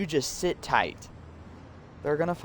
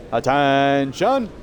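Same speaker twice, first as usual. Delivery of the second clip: drawn-out shout; 7 dB louder, much slower than usual. Acted. Captain calls inmates to order.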